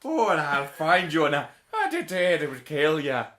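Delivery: loud, hammed-up Irish accent; Liam Neeson. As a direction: bellow an absurdly thick mock Irish accent. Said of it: "He's not that Irish in it".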